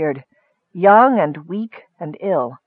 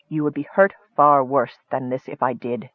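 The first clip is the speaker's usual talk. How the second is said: grimly; 5 semitones lower, 4 dB quieter than usual. A narrator's reading. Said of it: "said Raistlin grimly".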